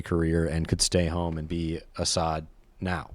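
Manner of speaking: quiet reading voice; reading a post aloud. Acted. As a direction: read the post to viewers in a low, soft voice. Reads a Reddit post aloud.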